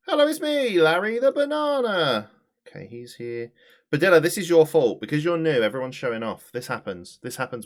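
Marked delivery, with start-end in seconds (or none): 0.1-2.3 very high, loud cartoon greeting; squeaky tiny character voice; Larry the Banana. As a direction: squeal hello, drawing out the name. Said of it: "Larry and Pablo sound the same".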